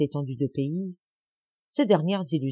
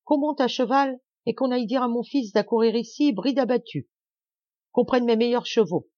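high-pitched, urgent commands; cried orders; 6 semitones higher than usual. A narrator's reading. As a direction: call out orders to a servant. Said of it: "cria-t-il encore".

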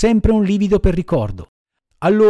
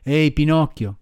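usual speaking voice

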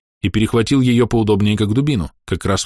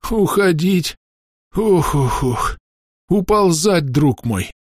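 groaning in pain; 9 semitones higher, much slower than usual. Acted. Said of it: "со стоном".

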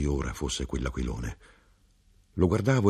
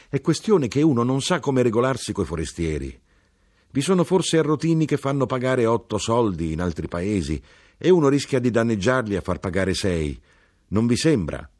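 higher-pitched, louder figure than the narration; earnest knife-grinder making excuses; knife-grinder explaining himself; 6 semitones higher, 4 dB louder than usual. Acted. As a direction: argue your case in a raised voice.

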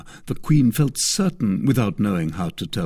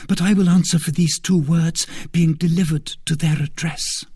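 high, urgent persuasion; eager devoted maid; 7 semitones higher than usual. A narrator's reading. Maid urging the queen to write the letter, eager voice. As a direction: win her over fast.

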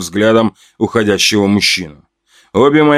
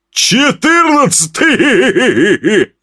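much higher, drawn-out gloating count; gleeful cackle; 11 semitones higher, much slower than usual. Acted. Axe killer counting his victims, gleeful. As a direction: savour the number with a chuckle. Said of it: "хохотнул бородач".

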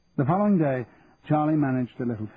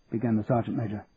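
plain reading voice

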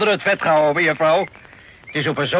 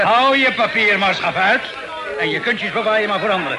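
angry refusal; 5 semitones higher than usual. Acted. Captain refuses recruiter angrily.

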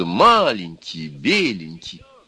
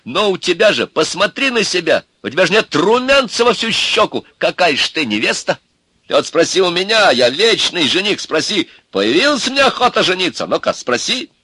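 high, loud indignant rant; heating up; 11 semitones higher, 6 dB louder than usual. A narrator's reading. Ivan berating Milka as he heats up, indignant. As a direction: get more and more worked up at her. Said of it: "Иван всё больше накалялся".

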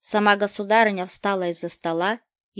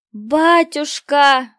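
high, loud, drawn-out call, much slower; joyful cry; 7 semitones higher, 10 dB louder, much slower than usual. Acted. Boy sees his father enter and cries out to him, high, loud, drawn out. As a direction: call out to a long-lost father. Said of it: "вскричал Мартын".

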